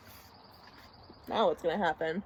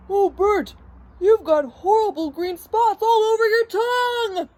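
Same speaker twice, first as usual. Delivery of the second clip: high, loud wail of mock horror; horrified; 10 semitones higher, 6 dB louder than usual. Ernie tricks Bert about his tongue, high mock horror. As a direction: cry out the dreadful news.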